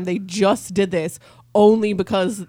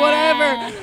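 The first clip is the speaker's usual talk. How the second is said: loud, high dismissive holler; mock crowd jeer; 7 semitones higher, 7 dB louder than usual. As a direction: keep heckling like an annoyed crowd. Still in jeering crowd voice.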